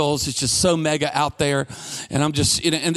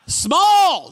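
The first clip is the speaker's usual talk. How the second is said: high, flustered little answer; blurting a size at the barista, high and unsure; squeaky small; 11 semitones higher, 5 dB louder than usual. Retold or imitated. At the counter, blurting a high squeaky order.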